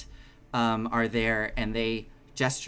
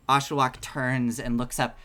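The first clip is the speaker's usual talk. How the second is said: ordinary delivery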